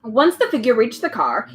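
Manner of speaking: ordinary delivery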